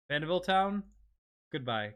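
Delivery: usual speaking voice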